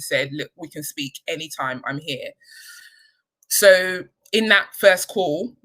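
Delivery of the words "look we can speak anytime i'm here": soft, gentle quote of the coach, much softer; lightly voiced, soothing coach saying she's there; a hint of the coach's calm reassurance; reassuring coach. Coach reassuring her on the phone.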